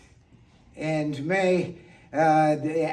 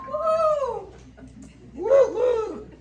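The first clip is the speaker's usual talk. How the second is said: high-pitched celebratory woo-hoo; festive whoop; slower than usual. Acted. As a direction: cheer in a very high voice. Cheering Saint Patty's Day's arrival.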